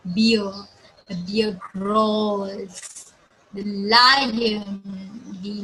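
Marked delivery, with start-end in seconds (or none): 1.7-3.1 very slow, stretched "growls"; drawn-out growls. Shown as a demonstration. Teacher shows the bear's sound, stretching "growls".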